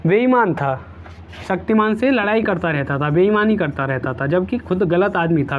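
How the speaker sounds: normal talking voice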